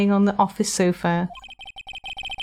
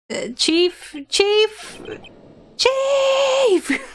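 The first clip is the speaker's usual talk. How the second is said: each call higher and more frantic; calling out in rising panic; rising panicked calls; 12 semitones higher, much slower than usual. Acted. Calling out, discovering body.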